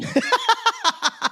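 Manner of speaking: helpless high-pitched laughter; high cackling laugh. Real, not acted. Cracks up after own mock quote.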